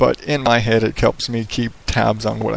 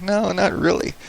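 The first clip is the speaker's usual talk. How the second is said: higher-pitched, drawn-up protest; retold denial; 8 semitones higher than usual. Host answering his imagined critic, higher-pitched mock denial. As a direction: push back in a raised pitch.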